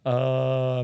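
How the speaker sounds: ordinary talk, nothing marked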